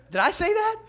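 very high, loud, incredulous question; mock disbelief. Preacher shocked at his own bold promise, mock disbelief. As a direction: squawk it, aghast at yourself. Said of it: "I couldn't believe I said it".

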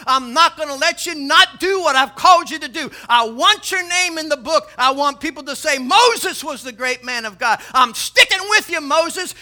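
loud, high, building declaration; impassioned preaching as God. Acted. God promising Moses a way forward, preached at full force.

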